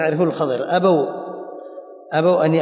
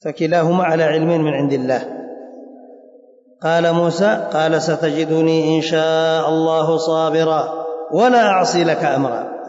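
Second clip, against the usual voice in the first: raised, measured reading of a religious text; formal text recitation; 2 semitones higher, 3 dB louder, slower than usual. Acted. Teacher reading the story to students, formal recitation. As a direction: read the text out, drawing out phrase ends.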